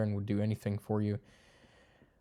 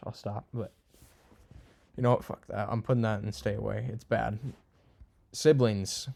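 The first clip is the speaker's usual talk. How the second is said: usual speaking voice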